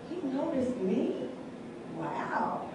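soft, slow, wondering question falling to a near whisper; hushed disbelief. Acted. Woman amazed the church man noticed her, hushed. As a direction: marvel under your breath. Awed reaction to man's attention.

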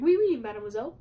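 light mock French accent, lifting at the end; half-hearted French accent. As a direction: repeat the greeting in a playful French accent. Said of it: "like a French accent".

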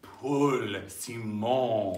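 slow, drawn-out glam announcement; grand, stretched-out showbiz intro. Pretend. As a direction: give the name some glamour. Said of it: "give him a little grassmataz, a little glamour".

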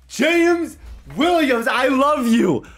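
high, loud, delighted praise; gushing excitement. Real, not acted. Gamer praising his star player in a high, loud, delighted voice. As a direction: gush over him like a favourite.

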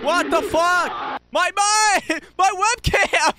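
high loud yell, then laugh; startled shout. Real, not acted. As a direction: shout from the scare. Jump scare hits streamer.

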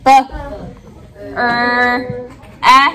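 "B", "er", "eh" as separate sounds; short "B", held "er", short "eh"; sounding out a word. Shown as a demonstration.

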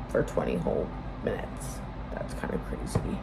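soft whispered aside; whispering. Real, not acted. Trails into an aside.

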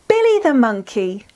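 usual speaking voice

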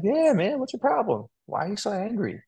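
lightly hammed-up indignation, pitch way up; mock indignant comeback. Telling off an apologetic customer, mock indignant and high. Retorting to rude support customer.

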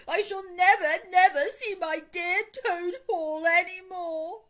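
high, loud, drawn-out theatrical wail; hammed-up sobbing, stretched out at the end. A narrator's reading. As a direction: bawl melodramatically over a lost home. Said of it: "sobbed the toad".

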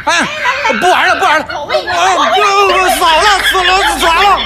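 high, frantic repeated protest; panicked backing out. Acted. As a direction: yelp it out, desperate to stop. Backing out of his own prank.

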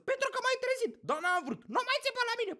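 high-pitched irritable squabble; acted bickering. Couple arguing over the alarm clock, mock irritated.

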